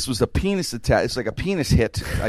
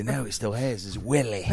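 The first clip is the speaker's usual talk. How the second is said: put-on light British mob accent, quieter; mock British gangster; 3 semitones lower, 6 dB quieter than usual. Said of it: "That's how they talk".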